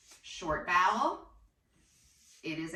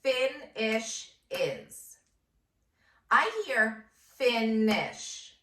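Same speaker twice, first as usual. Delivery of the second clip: very slow, stretched syllables; teacher slowing down to pull the word apart; slow segmenting; 3 semitones higher, 3 dB louder, much slower than usual. Shown as a demonstration. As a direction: draw each syllable out for a child. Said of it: "Do you hear a base word".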